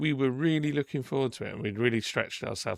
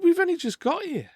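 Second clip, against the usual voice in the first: high, louder mock protest; indignant protest; 6 dB louder than usual. Answering the woman's complaint at the pool, indignant. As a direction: protest it in a raised, high pitch. Acted quarrel at water park.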